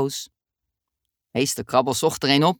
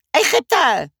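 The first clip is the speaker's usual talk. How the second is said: higher and louder eager guess; eager call-out; 10 semitones higher, 5 dB louder than usual. Acted. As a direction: blurt it out hopefully. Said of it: "riep Dummy". (enthousiast roepen)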